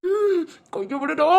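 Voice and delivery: high, slow strained cry; straining to break free. Acted. Captive visitor struggles in the man's grip, strained cry. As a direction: struggle against a grip while speaking.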